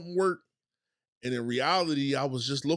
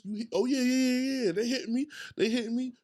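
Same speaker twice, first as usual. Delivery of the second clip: taunting boxer; 9 semitones higher than usual. Retold or imitated. Acting out taking punches.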